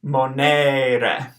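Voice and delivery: "monere" in three syllables, final e sounded; teacher modelling "monere"; Latin pronunciation. Shown as a demonstration. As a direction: say "monere" as mo-ne-re, voicing the last e. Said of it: "every vowel is always pronounced in Latin".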